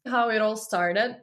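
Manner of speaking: usual speaking voice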